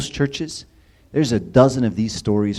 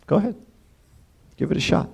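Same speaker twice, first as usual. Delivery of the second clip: higher, knowing go-ahead; indulgent father; 6 semitones higher, faster than usual. Retold or imitated. Father lets his son try alone, knowingly indulgent. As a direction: let him try, with a hint of a knowing smile.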